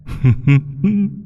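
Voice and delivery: airy, rising whispered giggle; breathy demon giggle. Acted. Demon patron laughing at her new pet, airy rising giggle. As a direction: giggle delightedly at the helpless mortal. Demon amused at own secret.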